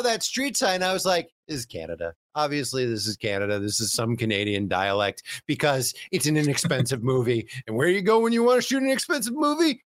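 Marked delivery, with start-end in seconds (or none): 1.5-9.8 mock certainty. Mocking own smug assumption.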